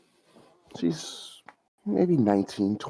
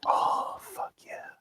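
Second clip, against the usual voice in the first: breathy whispered satisfaction; whispered relief; 4 dB quieter, slower than usual. Acted. McDonald's girl whispering after lighting the joint. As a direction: whisper it through the first hit.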